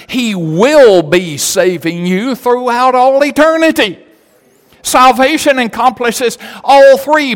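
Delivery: high, loud, emphatic pulpit delivery; fervent preaching. Real, not acted. Preacher proclaims past, present and future salvation, full-force preaching.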